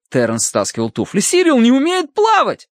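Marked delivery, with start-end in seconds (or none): 1.2-2.7 panicked cry. A narrator's reading. Terence fears for his drowning dog, panicked cry. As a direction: cry it out in desperation.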